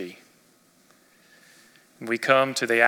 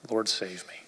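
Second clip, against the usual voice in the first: soft, sinking plea for rescue; a quiet, desperate cry of a man going under; hushed desperate plea; 5 semitones lower, 8 dB quieter than usual. Acted. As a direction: breathe it out like a drowning man. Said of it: "he cried out".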